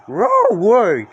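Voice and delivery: high loud dog-voiced no way; cartoon dog yelp; barked refusal. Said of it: "Scooby barked".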